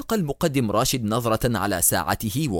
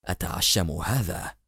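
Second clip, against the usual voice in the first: low, slow murmur through pain; 5 semitones lower, 5 dB quieter than usual. A narrator's reading. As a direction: murmur softly, as if hurting. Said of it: "غمغم المقدم راشد في ألم".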